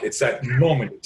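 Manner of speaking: ordinary delivery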